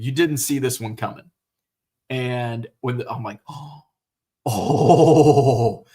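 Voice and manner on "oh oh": reenacted amazement. Viewer reacting to the big twist, exaggerated amazement. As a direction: draw out a mock-stunned ooooh.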